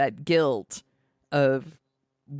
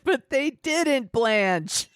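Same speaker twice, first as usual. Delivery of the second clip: Jane quote; 8 semitones higher, much slower than usual. Retold or imitated. Quoting Jane's movie line.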